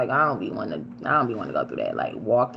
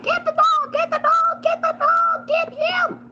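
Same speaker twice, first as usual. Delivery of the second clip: screaming frantically for the dog to be grabbed; retold scream; slower than usual. Driver screaming from the car at the owner, high frantic scream. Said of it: "I started screaming out the window".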